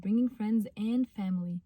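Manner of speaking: slow pronunciation practice; slow read-through. Shown as a demonstration. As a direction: say "bringing friends and family together" very slowly. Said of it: "تخشي على الكلمة اللي بعديها".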